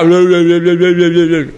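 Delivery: rapid run of blurred "bla bla" noise; garbled echoing babble. Shown as a demonstration. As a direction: babble like speech smeared by echo. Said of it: "lo que la gente lo que escuche sea".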